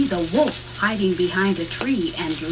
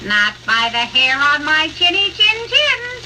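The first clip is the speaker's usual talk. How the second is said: higher, louder, firm refusal; brave pig; 7 semitones higher, 6 dB louder than usual. A narrator's reading. Third pig defies the hungry wolf, bravely. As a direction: stand up to the wolf boldly. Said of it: "said bravely".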